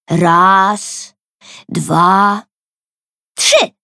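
excited countdown yell. A narrator's reading. Sister counts down before the door opens, shrieking at the end. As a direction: count down, then scream. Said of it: "wrzasnęła Tosia".

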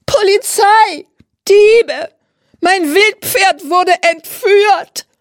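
a frantic owner shrieks that her horse was stolen; panicked shrieking. A narrator's reading. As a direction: wail the alarm to everyone. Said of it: "kreischt sie".

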